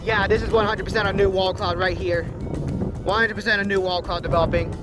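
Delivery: ordinary talk, nothing marked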